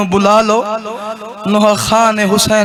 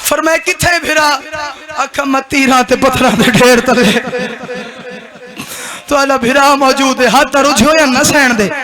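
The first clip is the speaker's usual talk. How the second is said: wailing lament chant, building in waves; keen the elegy to the mourners; mourning recitation; 3 semitones higher, 4 dB louder than usual. Acted. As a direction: zakir reciting masaib, weeping and chanting.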